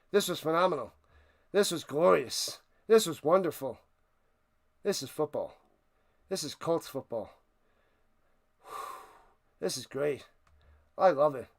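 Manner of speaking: low, soft, winded praise; spent awe. Real, not acted. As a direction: let the voice sink, savoring the win. Spent after celebrating the win.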